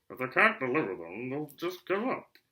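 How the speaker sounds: talking through nails; mouth full. A narrator's reading. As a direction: mumble round a mouthful. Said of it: "through a mouthful of nails".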